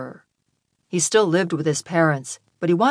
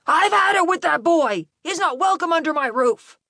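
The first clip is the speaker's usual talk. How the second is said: high, raised-voice rant; fed-up dad still yelling; angry father; 12 semitones higher than usual. A narrator's reading. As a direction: declare your son banished. Father storms off after the fight.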